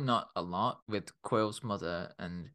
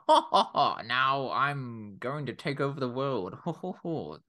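mustache-twirling villain; 2 semitones higher than usual. Shown as a demonstration. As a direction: play a cartoonish villain, drawing out the words. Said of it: "let's define what a mustache twirly villain is".

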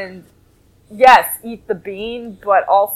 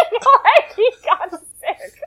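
high voice breaking into laughter; laughing; 11 semitones higher, slower than usual. Real, not acted. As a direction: argue the joke while cracking up. Joking through own laughter.

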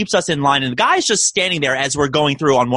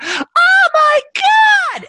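put-on high, loud panicked shriek of a teen girl; retold girl's scream, pitched way up and loud; imitated scream; 4 dB louder, much slower than usual. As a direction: shriek it like you just got scared. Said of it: "like, this screaming".

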